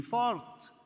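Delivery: a mild, high mock cry; erzählter Schrei. A narrator's reading. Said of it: "schrie sie". (gedämpfter, hoher Schrei)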